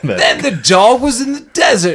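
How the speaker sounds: high, loud, hammed-up British accent reading a story; mock British accent. Imagining Nigella reading the book aloud, mock British accent. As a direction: put on a silly rough British storyteller accent. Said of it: "that seems like dirty British".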